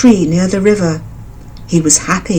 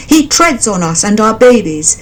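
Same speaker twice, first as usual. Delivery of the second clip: higher frog voices, helpless; complaining frogs; 6 semitones higher than usual. A narrator's reading. Frogs complaining to the mother bird, higher. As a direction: complain about the bully.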